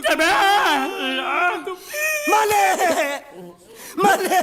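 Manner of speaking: drawn-out comic wail, rising; wailing cry. Acted. Servant wailing as his master leaves him. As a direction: howl the master's name in mock despair.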